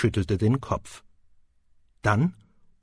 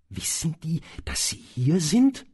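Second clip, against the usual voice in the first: curious; 6 semitones higher than usual. Acted. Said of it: "fragte Harry neugierig".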